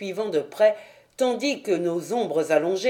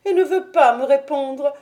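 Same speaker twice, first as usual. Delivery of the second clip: put-on high old woman's voice, urgent and fretful; a frightened servant urging her master upstairs; anxious old housekeeper; 8 semitones higher than usual. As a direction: plead with them to go look, voice full of worry. Old housekeeper reports her fear.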